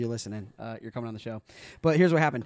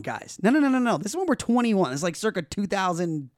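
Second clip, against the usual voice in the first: normal talking voice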